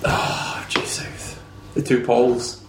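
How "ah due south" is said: whispering. Real, not acted. Fond whispered echo of show title.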